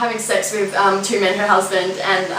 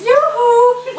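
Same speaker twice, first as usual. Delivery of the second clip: sing-song high, loud yoo-hoo; Colleen yoo-hoo; 13 semitones higher, 5 dB louder than usual. Retold or imitated. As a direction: trill it like the nosy neighbour calling out. Colleen's catchphrase mimicked.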